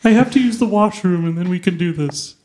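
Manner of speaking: high Muppet-like president; Abe Lincoln. Acted. President needs the washroom first, high Muppet-ish voice. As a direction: hapless impression, Ernie meets Kermit the Frog. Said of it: "That was Muppet-ish".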